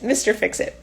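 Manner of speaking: plain reading voice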